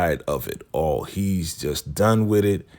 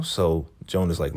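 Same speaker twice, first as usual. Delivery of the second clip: ordinary talk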